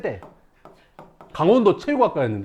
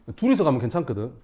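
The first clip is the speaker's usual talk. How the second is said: ordinary delivery, nothing marked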